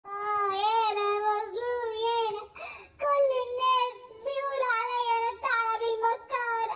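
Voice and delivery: hammed-up self-pity to trick the rabbit; fake crying fox. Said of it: "ما تعيطش".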